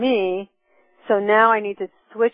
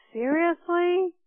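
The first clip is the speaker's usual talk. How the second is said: incredulous; 9 semitones higher, 3 dB quieter, slower than usual. Retold or imitated. Exasperated at herself.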